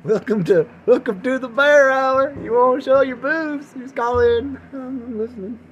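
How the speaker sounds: loud, high hammed-up host voice; sleazy radio host. Host hamming up a sleazy radio show intro. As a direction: play a cheesy late-night DJ.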